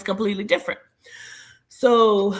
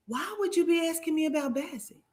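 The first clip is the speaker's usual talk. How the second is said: usual speaking voice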